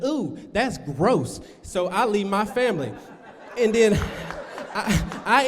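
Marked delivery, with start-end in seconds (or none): 0.0-1.4 slow, drawn-out mock disgust; disgusted child. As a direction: recoil in acted-out disgust. Disgusted child in retold story.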